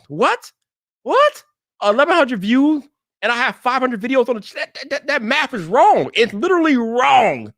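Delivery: high-pitched, disbelieving rant; incredulous outburst. Real, not acted. Creator to viewers about paltry view count, incredulous. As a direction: blurt it out in rising disbelief, stretching the key words.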